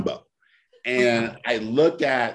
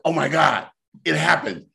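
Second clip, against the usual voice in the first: a lightly raised, giddy retold gasp; thrilled; 7 semitones higher than usual. Retelling to a friend how the order worked, mildly giddy. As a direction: replay the moment you thought you'd made it.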